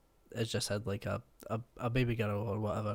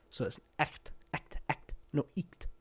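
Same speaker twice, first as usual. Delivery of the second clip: ordinary delivery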